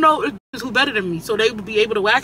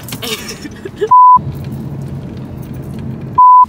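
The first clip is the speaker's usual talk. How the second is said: big high laugh; 5 dB louder than usual. Real, not acted. Laughs at own joke.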